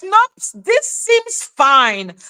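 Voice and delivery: a high, slow mock-innocent drawl; mocking Melissa. Mocking Melissa who shrugs off the betrayal, in a high, slow mock-innocent voice. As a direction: sarcastically play it off as nothing. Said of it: "Melissa's like".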